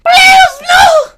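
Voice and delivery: very high, loud screaming plea; terrified plea. Acted. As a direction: scream it out in hammed-up terror. Bee pleads in terror.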